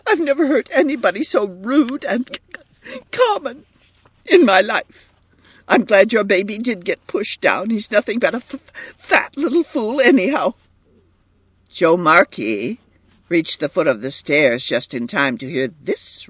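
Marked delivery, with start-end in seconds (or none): 0.1-10.5 high, tearful insults, catching on words; sobbing. A narrator's reading. Edith insults the mother and baby as she cries, sobbing. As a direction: stammer through tears, voice pitched way up. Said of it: "she sobbed".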